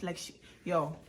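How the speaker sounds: usual speaking voice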